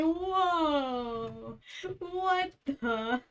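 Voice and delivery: high, drawn-out stunned whoa; stunned disbelief. Retold or imitated. Bystander hearing the husband's jarring accent, stunned and high-pitched. Retold shock at accent switch.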